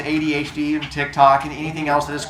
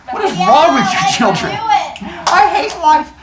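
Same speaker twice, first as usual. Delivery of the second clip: mock exasperation; 12 semitones higher, 4 dB louder than usual. Real, not acted. Teacher exasperated with the class.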